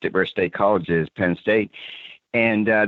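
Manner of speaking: ordinary talk, nothing marked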